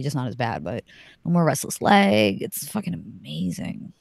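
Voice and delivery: normal talking voice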